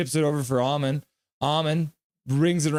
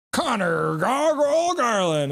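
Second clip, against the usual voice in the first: higher, slow announcer call; mock announcer; 9 semitones higher, slower than usual. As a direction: bellow the name like an arena announcer. Announcing a player nickname.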